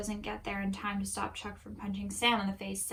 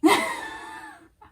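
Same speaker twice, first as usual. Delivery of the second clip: high squealing laugh; 11 dB louder than usual. Real, not acted. Reacting to old self.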